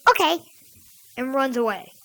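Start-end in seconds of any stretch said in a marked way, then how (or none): 0.1-0.4 put-on quick, high little-kid okay; kid cousin. Cousin agrees before running back to bed, high kid voice. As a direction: chirp it like an obedient child. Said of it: "She goes".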